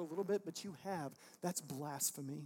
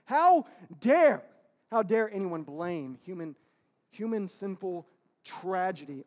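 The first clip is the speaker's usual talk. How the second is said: indignant outburst; 12 dB louder than usual. Real, not acted. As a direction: explode in outrage, pausing after the first phrase. Preacher indignant at blaming God.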